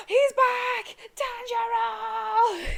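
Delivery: gasp, then cry out high and loud; excited gasp and yell. Retold or imitated. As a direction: shout his name in shocked joy. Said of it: "everyone just goes".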